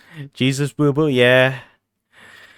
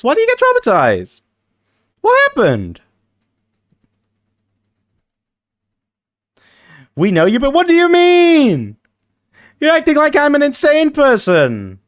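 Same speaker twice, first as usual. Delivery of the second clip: high, louder squeaky voice; leprechaun voice; 13 semitones higher, 4 dB louder than usual. Pretend. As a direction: protest in a squeaky goblin voice. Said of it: "the leprechaun voice".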